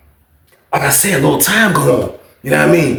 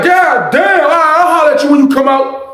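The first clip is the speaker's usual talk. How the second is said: higher, hammed-up grumbling old man; uncle voice; 10 semitones higher than usual. Uncle leaving.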